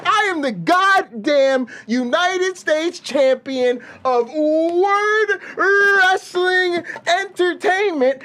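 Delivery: pumped-up ring champion proclaiming his title; wrestling promo. Acted. Hyped wrestling-promo rant.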